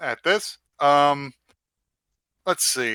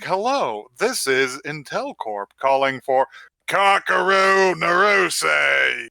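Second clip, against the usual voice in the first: robocall voice; 3 semitones higher, slower than usual. Pretend. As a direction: announce the name like a robot caller. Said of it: "like a fucking heavy Brooklyn accent".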